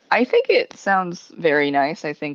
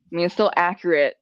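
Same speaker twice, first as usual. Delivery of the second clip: ordinary talk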